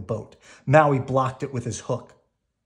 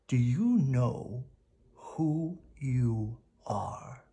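slow, breathy whisper; 4 dB quieter, much slower than usual. A narrator's reading. Grandma whispers to Moana, slow and hushed. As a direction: whisper the question slowly.

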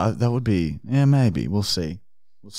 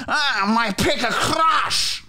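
wailing; 6 semitones higher, much slower than usual. Acted. Repeated wailing line.

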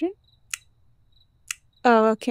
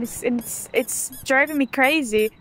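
ordinary delivery

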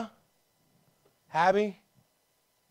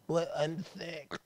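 slow, higher, feeble put-on complaint; mock sick whining; 4 semitones higher, much slower than usual. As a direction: drag it out like a hammed-up invalid. Mimics someone excusing themselves as sick.

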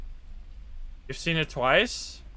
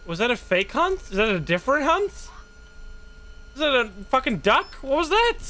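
rising, higher and higher astonished questions; startled disbelief; 8 semitones higher than usual. Real, not acted. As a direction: caught off guard, voice climbing up. Surprise at a sudden ghost event.

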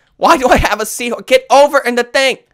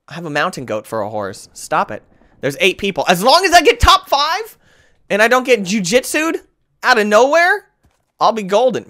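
ordinary delivery

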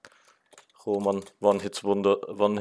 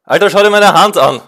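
excited; 8 semitones higher, 13 dB louder than usual. Real, not acted. Gamer shaking after win.

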